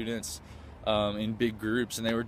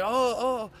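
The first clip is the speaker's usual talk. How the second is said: imitating excited fans; 10 semitones higher than usual. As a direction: mimic fans thrilled to see you. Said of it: "they were just screaming and clapping".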